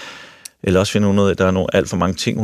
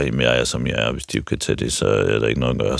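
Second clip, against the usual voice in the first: a lower, heavier voice for the stubborn grown men; gruff grown man; 8 semitones lower than usual. Retold or imitated. Speaker mimicking stubborn grown men, lower voice. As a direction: drop the voice low like a big man shrugging it off. Quoting big grown men's excuses.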